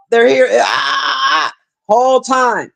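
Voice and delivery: high, stretched-out mock cry of a believer; mock wailing believer. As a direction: wail it like a desperate believer. Mocking alien believers' cry.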